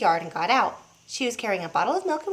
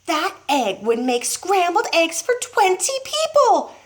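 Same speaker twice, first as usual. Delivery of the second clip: amazed mother; 8 semitones higher than usual. A narrator's reading. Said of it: "Missus Cranky said".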